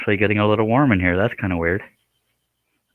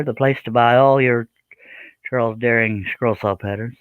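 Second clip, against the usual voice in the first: normal talking voice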